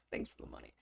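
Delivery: sly whispered thanks; mock-greedy whisper. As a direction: whisper it like a secret. Jokingly taking unblock fee.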